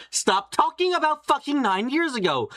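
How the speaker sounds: exasperated whine. Real, not acted. Exasperated at Lotus repeating herself.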